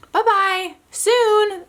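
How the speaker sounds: high little "Bye-bye, soon"; toddler voice. Retold or imitated. Mom imitates her daughter saying goodnight from the crib, high. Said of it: "June last night goes".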